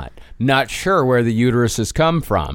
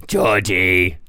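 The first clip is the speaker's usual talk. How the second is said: low dog voice; Georgie voice; 8 semitones lower than usual. Retold or imitated. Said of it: "what's your Georgie voice".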